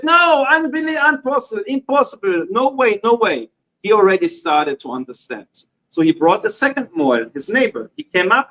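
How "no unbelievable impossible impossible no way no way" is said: much higher, incredulous protest, tumbling out fast; a man refusing news about his own body, in high-pitched disbelief; stunned disbelief. Retold or imitated. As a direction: cry out that it can't be true. Man told he's already circumcised.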